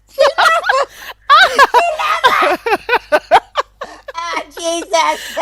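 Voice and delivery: high, helpless laughter breaking words; laughing till nearly crying; laughing hard. Real, not acted. Can't finish for laughing.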